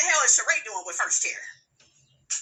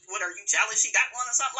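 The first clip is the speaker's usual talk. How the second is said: normal talking voice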